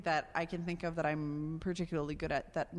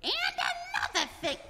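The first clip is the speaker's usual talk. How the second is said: high pigeon launching a complaint; pigeon grumble; much faster than usual. Acted. Pigeon keeps arguing, high pitched. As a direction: start another gripe as the pigeon. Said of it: "the pigeon goes".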